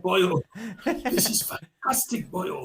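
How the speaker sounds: laughing through a light Welsh headmaster voice; mock Welsh delight with a laugh; Welsh accent. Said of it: "excuse my Welsh".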